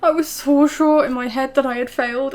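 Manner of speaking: normal talking voice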